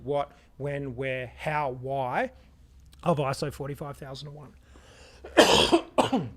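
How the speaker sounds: hoarse, failing voice; raspy whisper. Real, not acted. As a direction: voice fading to a rasp. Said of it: "I'm losing my voice".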